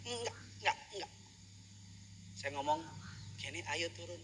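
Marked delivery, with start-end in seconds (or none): 0.1-1.1 put-on high, soft little-kid refusal; imitated refusing child. Father imitating his child refusing to get out, childlike refusal. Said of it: "dia nggak mau turun dari mobil". 3.4-4.2 quiet, gentle coaxing; soft, gentle tone. Retold or imitated. Father coaxing his child out of the car, softly. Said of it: "Nada pelan, nada lembut".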